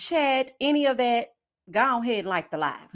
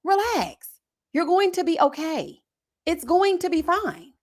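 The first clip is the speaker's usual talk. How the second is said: mock reassuring; 6 semitones higher, slower than usual. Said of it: "And I'm like".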